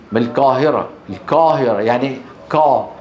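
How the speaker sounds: القاهرة with ق said close to k; Egyptian k-like Qaf. Shown as a demonstration. Teacher imitating brothers from Egypt, ق as k. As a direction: soften the ق of القاهرة towards ك. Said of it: "أو أحيانًا كاف خالصة".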